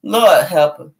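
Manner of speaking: loud, exasperated sigh to heaven; lightly voiced despairing guardian. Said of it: "I'll see my higher self like".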